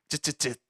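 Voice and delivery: disapproving tsk tsk tsk clicks; tongue clicking. Shown as a demonstration. Pastor showing kids how they tut at siblings, disapproving clicks. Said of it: "이렇게 혀를 찰 때가 있어요".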